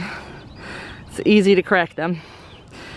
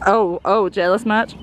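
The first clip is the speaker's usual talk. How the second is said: ordinary delivery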